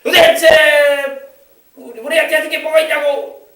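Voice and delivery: hammed-up, high and loud drama outburst; resentful child. Preacher acts TV child accusing parents, mock resentful outburst. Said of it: "아버지를 원망하고 어머니를 원망하고".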